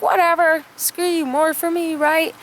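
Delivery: high, dismissive big sister; brushing off a tattling brother; retold shrug-off. Kim dismissing her brother as he runs inside, high and flippant.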